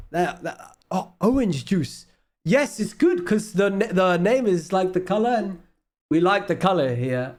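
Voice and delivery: hammed-up Dutch accent, lively and high. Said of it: "Worst Dutch accent".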